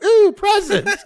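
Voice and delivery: put-on very high, loud childlike squeal; mock delighted excitement. Teller handed an early Christmas present, hammed-up delight. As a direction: squeal it like a kid on Christmas morning. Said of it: "her eyes light up like it's Christmas morning".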